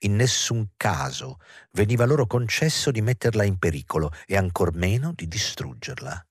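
ordinary delivery

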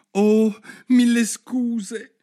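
loud, high, drawn-out apology; mortified exclamation. A narrator's reading. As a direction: exclaim it in flustered embarrassment. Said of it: "esclamò tutta mortificata". (esclamazione mortificata, acuta e lenta)

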